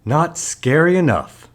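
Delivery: high, loud complaint; dissatisfied kid. A narrator's reading. Lance judges his first attempt on his father, dissatisfied. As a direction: pitch it up as a let-down child.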